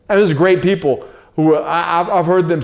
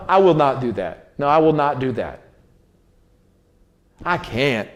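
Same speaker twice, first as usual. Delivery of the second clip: calm and firm, set down low; firm refusal; 3 semitones lower than usual. Shown as a demonstration. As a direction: state it as a decision, not a complaint. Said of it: "I would rather say".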